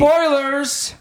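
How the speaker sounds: high, loud mock warning call; dramatic announcer. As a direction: blare it like an alarm announcer. Dramatic warning before reading review.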